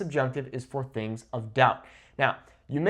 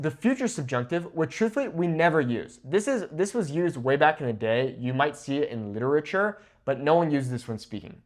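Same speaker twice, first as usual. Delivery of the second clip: normal talking voice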